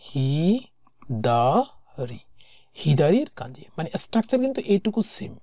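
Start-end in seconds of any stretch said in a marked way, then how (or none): 0.1-2.2 হিদারি drawn out very slowly, each syllable held, falling at the end; slow sounded-out word. Shown as a demonstration. Teacher slowly sounding out হিদারি for students. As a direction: stretch হিদারি so learners catch every sound. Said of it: "যেমন এটা হচ্ছে হিদারি".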